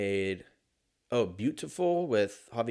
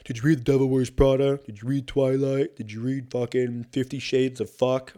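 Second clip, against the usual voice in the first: mocking fan chatter. Mocking what everybody asked.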